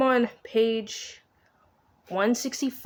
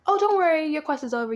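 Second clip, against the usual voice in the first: high, sing-out reassurance in a retelling; loud, high quoted reassurance, easing down; mock reassurance; 8 semitones higher, 6 dB louder than usual. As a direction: breezily voice someone brushing off worries. Voices the staff's breezy reassurance.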